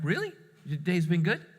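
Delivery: high-pitched questioning doubt; mock disbelief. Dad questioning child about the day, disbelieving. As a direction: lift it sharply into a sceptical question.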